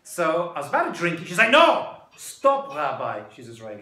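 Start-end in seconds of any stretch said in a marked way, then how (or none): 1.5-3.2 loud, high "No!", dropping on Rabbi; acted-out shout to stop someone; urgent halting command. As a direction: cut in sharply, playing the alarmed guide. Winery guide stops him drinking.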